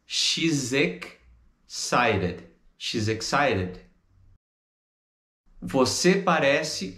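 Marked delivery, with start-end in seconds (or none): 0.1-2.4 "She's ex... cited", very slow with a gap; broken into chunks. Shown as a demonstration. Teacher breaks down the linking, very slow chunks. 2.8-3.8 "She's excited" as one linked word; joined up. Shown as a demonstration. Teacher puts the chunks back together, linked.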